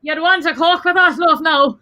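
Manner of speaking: mock Cork accent, teasing the Dublin girl. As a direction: sing it up like a Cork barman. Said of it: "Sorry, I need to do a Cork accent".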